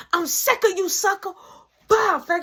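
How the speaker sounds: a mother scolding at the top of her voice; mama yelling. Retold or imitated. Mama yelling at son Mark.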